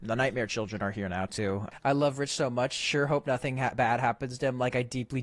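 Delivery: normal talking voice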